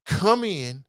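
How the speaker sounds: ordinary talk, nothing marked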